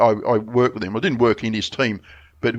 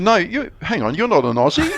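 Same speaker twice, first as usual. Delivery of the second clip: higher, teasing and incredulous; laughing disbelief; 7 semitones higher than usual. Retold or imitated. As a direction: call his bluff with a laugh. Manchester women doubt his claim.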